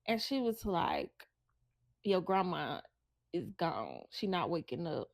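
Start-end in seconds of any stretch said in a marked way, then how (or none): 2.0-5.1 low, soft, halting delivery; grave bad news. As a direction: break the death gently, with heavy pauses. Aunt breaking news of a death.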